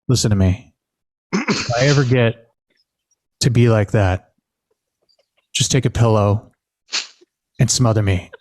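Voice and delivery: low, deliberate retelling with heavy pauses; dead serious. Retold or imitated. Uncle instructing his nephew after visiting great grandma, dead serious. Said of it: "but he wasn't laughing".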